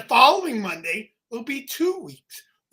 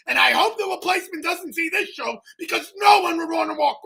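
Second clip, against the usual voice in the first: exaggerated excitement; 6 semitones higher than usual. Shown as a demonstration. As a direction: pump it up to show him how. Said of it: "You gotta put excitement".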